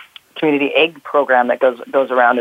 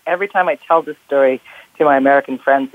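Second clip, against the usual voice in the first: ordinary delivery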